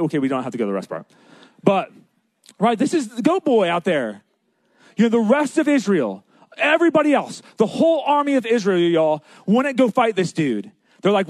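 normal talking voice

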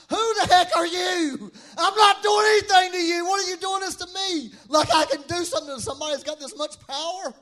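high, loud mock-indignant protest; hammed-up, incredulous outburst at a stronger power; indignant Paul. Comic acting of Paul's indignant answer.

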